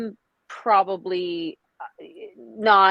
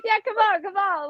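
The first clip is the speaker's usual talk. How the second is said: 1 mock plea; 12 semitones higher than usual. Joking plea for a ring.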